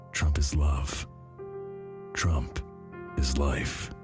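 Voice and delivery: ordinary talk, nothing marked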